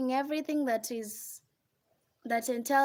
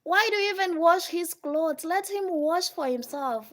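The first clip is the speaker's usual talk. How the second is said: nagging friend; 5 semitones higher, 4 dB louder than usual. Retold or imitated. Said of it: "They're like".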